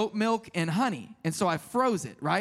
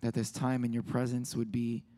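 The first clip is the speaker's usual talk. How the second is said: prayer; 7 semitones lower, 6 dB quieter than usual. Acted. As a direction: pray quietly, unhurried. Leads a prayer.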